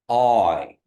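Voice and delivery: slow gliding oi; teacher models the oi diphthong; Kiowa oi. Shown as a demonstration. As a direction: glide oi from open o to i. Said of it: "like you're saying oi".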